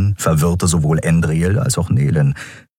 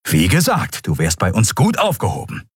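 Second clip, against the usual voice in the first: high-pitched, insistent lecture; stern urging; 10 semitones higher than usual. A narrator's reading. Admiral urging the prisoner to change course, stern. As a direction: press them to take this seriously.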